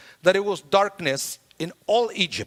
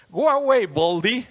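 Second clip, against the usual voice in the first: raised, louder taunting call; bully's jeer, pitched up; mocking yell; 5 semitones higher, 4 dB louder than usual. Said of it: "bullies who started to yell at him".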